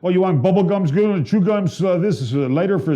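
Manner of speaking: high, eager pushy clerk voice; gas station clerk upsell. Retold or imitated. Mimicking the gas station clerk pushing extras, high and eager. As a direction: rattle off the upsell like a pushy cashier. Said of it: "those fucking guys at the Esso".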